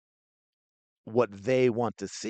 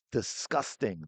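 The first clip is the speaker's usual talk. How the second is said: usual speaking voice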